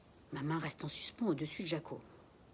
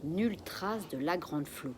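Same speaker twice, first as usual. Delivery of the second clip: ordinary delivery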